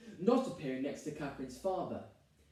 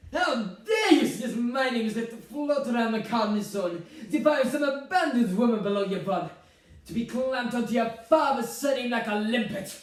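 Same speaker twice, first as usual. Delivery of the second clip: loud, raised voice full of outrage; indignant mother; 5 semitones higher, 6 dB louder than usual. Acted. Mother scolding son over her name, indignant. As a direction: rail at him as if mortally insulted.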